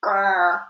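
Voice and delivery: rumbling warning growl; dog growl. A narrator's reading. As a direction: growl at the stranger. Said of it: "growled at the mysterious figure".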